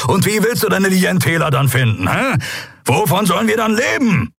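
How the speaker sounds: high, pressing rebuke with a shout at the start; snapping. A narrator's reading. Kero rebukes the captain over losing the ship, angry. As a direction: drive the point home to the captain. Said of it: "als Kero sie anfuhr".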